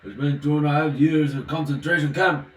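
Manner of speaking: loud put-on Russian accent, punching camp; mock Russian accent. Performing George Solange for viewers, loud mock Russian accent. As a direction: boom it out in a hammed-up Russian accent. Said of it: "I'm gonna act like George Solange".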